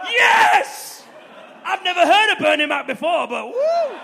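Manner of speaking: loud, high whooping cheer; mock crowd cheer. Arena crowd cheering a name they don't know, loud whooping. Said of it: "everyone's gonna go".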